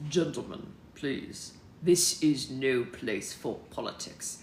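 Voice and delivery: low, slow American statesman; American accent. Acted. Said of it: "this is even better".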